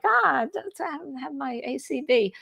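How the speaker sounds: startled realization. Retold or imitated. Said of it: "and I'm like".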